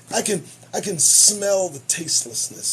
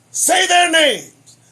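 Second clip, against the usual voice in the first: mocking; 9 semitones higher, 9 dB louder, slower than usual. Mocking the slogan.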